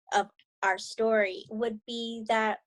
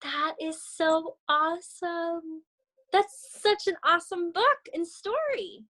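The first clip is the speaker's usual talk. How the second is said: excited; 7 semitones higher than usual. Real, not acted. Teacher gushes after finishing book.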